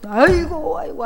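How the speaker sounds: imitating a mourner's wailing cry; mock wailing. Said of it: "하면서 이렇게 우는 거죠".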